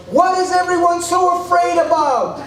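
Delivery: high-pitched yell while falling. Retold or imitated. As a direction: shout it out as you fall. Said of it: "I yelled".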